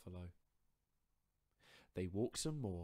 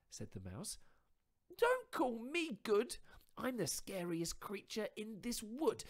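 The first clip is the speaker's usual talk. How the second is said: higher, louder, quick bold bluff; bluffing little mouse; 11 semitones higher, 6 dB louder than usual. Acted. Mouse bluffing the Gruffalo, higher and louder. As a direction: mouse putting on a brave front.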